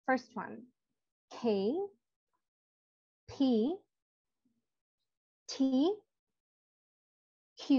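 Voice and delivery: each letter said slowly and apart, K P T Q; teacher spelling out K, P, T, Q one by one; slow demo letters. Shown as a demonstration. Teacher showing the class how to say each letter. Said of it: "আমি আবার repeat করছি".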